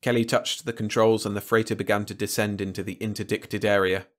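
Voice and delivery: plain reading voice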